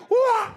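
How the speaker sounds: very high, loud war whoop; battle cry. Acted. Chopping off giant's head.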